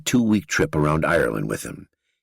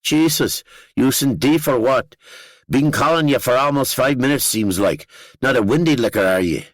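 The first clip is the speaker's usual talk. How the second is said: high, loud, thick Northern Irish teasing; Danny, thick accent; 9 semitones higher, 4 dB louder than usual. A narrator's reading. Danny teasing the drunk narrator after the flight, thick Northern Irish accent. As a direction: rib him in broad Northern Irish. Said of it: "His accent thickened the closer we came".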